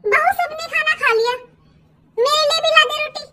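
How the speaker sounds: a mother-in-law ordering from her seat; demanding mother-in-law. Acted. (ऊँची आवाज़ में हुक्म देती सास)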